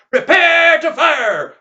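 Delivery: slow, high, drawn-out shout; hollered command. Acted. Veteran to seven gunmen, hollered firing order.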